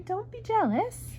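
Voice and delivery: high, slow, drawn-out mother's voice; patient mother, stretched out high; chiding mother. A narrator's reading. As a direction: gently scold in a high storybook voice. Mother chides child in story.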